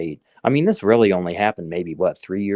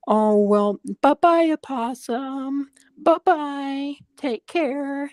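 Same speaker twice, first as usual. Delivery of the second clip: high, slow singsong mock voice; mocking dumb worker; much slower than usual. Mocking the worker who freed the opossum, high singsong. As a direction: mimic her as a chirpy airhead. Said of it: "stupid ass bitch proceeds to say".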